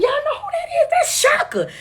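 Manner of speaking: high, shouting hype to the crowd; excited hype. Retold or imitated. Host hyping the audience about her singing, high shout. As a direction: announce her like a star.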